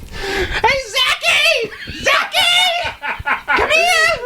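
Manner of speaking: very high, shrieky mock grandma holler; grandma calling. Grandma calling Zachy to come here, very high holler. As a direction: call the child in like grandma. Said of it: "Like grandma".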